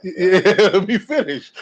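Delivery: amused, good-natured protest; laughing. Real, not acted. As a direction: laugh through the interruption. Laughs while reclaiming his turn.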